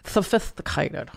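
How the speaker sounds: mock-posh lisp on Sophisticated, s sounds as th; lisping fancy voice. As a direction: lisp it like a snob. Echoes in a lisping voice.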